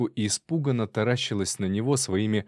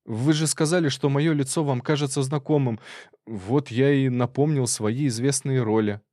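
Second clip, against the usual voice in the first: high-pitched actor justifying himself; higher, pleading character voice; anxious robber explaining; 4 semitones higher than usual. Acted.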